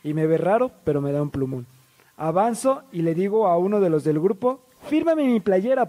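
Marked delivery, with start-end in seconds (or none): 4.9-5.9 high, eager fan plea; imitated excited fan asking; acted eager request. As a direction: ask for an autograph with your voice pitched up. Asking band member for autograph.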